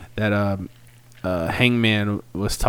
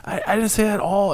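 indignant Hangman; 9 semitones higher, faster than usual. Retold or imitated. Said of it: "He's like".

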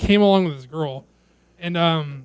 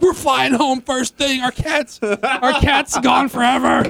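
high, slow mock wailing; mock distraught wail; 6 semitones higher, slower than usual. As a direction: whine it out like a panicked owner. Panicked owners quoted.

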